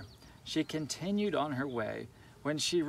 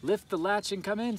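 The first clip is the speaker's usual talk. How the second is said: grandmother voice; 6 semitones higher, 3 dB louder than usual. A narrator's reading. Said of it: "said grandmother".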